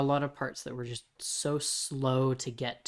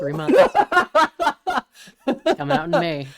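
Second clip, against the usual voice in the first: words through laughter, louder; laughing; 13 semitones higher, 12 dB louder than usual. Real, not acted. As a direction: say it while cracking up. Laughing while speaking.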